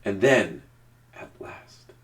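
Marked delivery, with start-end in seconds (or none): 1.2-1.9 breathy hushed aside; whispered. A narrator's reading. Narrator building suspense before Harry's name, whispered. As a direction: hush it for suspense.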